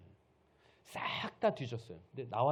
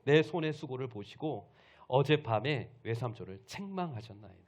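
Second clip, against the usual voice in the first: forceful final rebuke; 9 dB louder than usual. Acted. Jacob makes his last charge to his uncle, forceful rebuke. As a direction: proclaim it with weighty indignation. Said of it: "그래서 마지막 이렇게 얘기하는 거예요".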